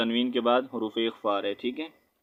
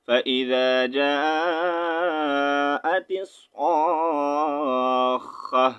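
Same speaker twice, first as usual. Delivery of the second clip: slow, drawn-out chanted recitation; Quran recitation; 2 semitones higher, much slower than usual. Shown as a demonstration. Teacher showing students how the verse is recited, slow chant. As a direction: recite it slowly like in prayer.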